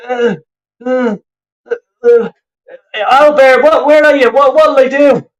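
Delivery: high-pitched, stammering mock helplessness; mocking flustered manager. Fan imitating manager lost on the touchline, mock flustered. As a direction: dither and flounder as a clueless coach.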